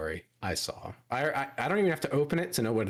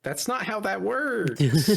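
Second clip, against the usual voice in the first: exasperated protest; 11 semitones higher, 6 dB louder, much slower than usual. Real, not acted. Protesting the stinky joke.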